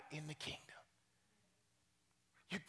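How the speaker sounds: sudden whispered aside to the congregation; hushed. Acted. Preacher whispering the punchline to the church. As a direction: drop to a breathy whisper for effect.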